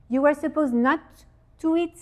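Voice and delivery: normal talking voice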